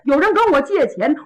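ordinary delivery

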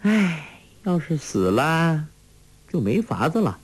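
a put-on helpless sigh; sighing regret. A narrator's reading. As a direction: sigh it out as a lost cause. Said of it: "黄蓉叹道".

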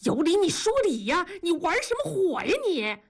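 high, exasperated scolding. A narrator's reading. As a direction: scold sharply with the pitch lifted. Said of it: "同志大声说".

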